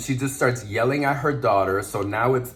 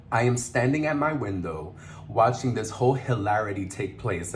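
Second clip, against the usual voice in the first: ordinary delivery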